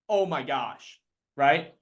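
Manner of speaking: ordinary talk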